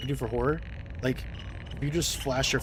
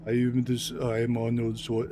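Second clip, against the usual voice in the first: bad Arnold attempt; slower than usual. Shown as a demonstration. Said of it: "it would sound terrible".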